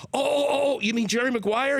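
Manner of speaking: light up as it clicks; excited realization. Retold or imitated. [name] realizing the movie.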